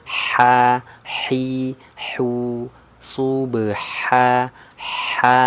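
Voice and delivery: "Ha, hi, hu" with airy throat h; breathy small ha. Shown as a demonstration. Teacher drilling the light h for students. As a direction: let the air flow out on each h. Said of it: "Anginnya keluar".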